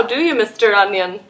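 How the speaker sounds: ordinary delivery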